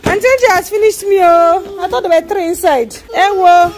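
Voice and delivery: high, loud lament; loud dismay. Real, not acted. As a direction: exclaim in dismay at coming up short. Dismayed at too few gifts.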